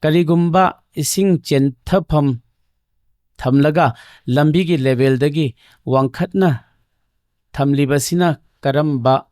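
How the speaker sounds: slow, measured read-aloud with pauses between phrases; deliberate question-reading voice, pausing often. Real, not acted. Reads out a submitted question.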